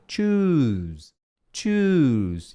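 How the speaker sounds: overlong, stretched "Choose"; exaggerated "choose". Shown as a demonstration. Teacher telling students to exaggerate "choose". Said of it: "você pode exagerar mesmo".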